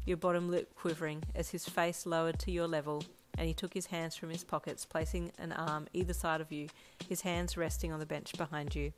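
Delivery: usual speaking voice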